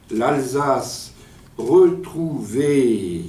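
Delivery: slow, drawn-out poetry declamation; déclamation poétique. Acted. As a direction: declaim it line by line, stretching the final words. Lit son poème à voix haute. (déclamation poétique lente, mots étirés)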